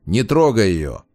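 ordinary delivery

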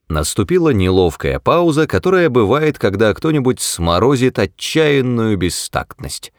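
usual speaking voice

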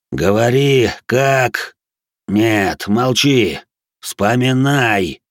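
forceful, drawn-out growling orders; growled command. A narrator's reading. Possessor forces the captive to remember, growling. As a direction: growl each order, stretching the last. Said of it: "прорычал мой голос".

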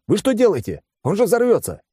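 alarmed, high-pitched yelling. A narrator's reading. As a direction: cry out a warning with pitch pushed way up. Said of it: "заорал я". (высокий встревоженный крик)

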